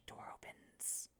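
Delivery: slow, trailing whisper; hushed effect. Retold or imitated. Storyteller shows the puzzle solved, hushed effect. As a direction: whisper it like a mechanism sliding open. Mimics the door opening hushed.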